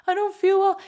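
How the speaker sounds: high, pitiful little voice; whiny sick friend. Retold or imitated. Friend calling in sick, moaning in a high voice. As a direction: play the poorly friend, high and weak. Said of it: "your friend rang you and was like".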